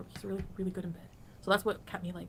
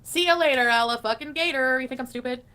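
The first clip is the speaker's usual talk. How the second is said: mocking shout; 5 semitones higher, 11 dB louder than usual. Quoting him, mocking.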